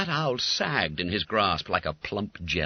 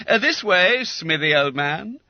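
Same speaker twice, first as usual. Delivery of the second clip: cheery schoolboy; 11 semitones higher, 7 dB louder than usual. A narrator's reading. Bob calling.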